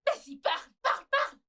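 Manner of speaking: high, taunting demand; challenging goad. Acted. Rival goads her to speak.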